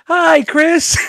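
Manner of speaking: imitating group greeting. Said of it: "we all said".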